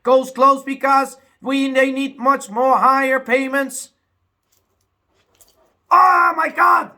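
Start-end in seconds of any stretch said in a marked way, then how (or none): 5.9-6.9 loud high angry cry; mad outburst. Real, not acted. Ranting about his country's problems, bursting out angry. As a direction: explode in real frustration. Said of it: "I get mad about it".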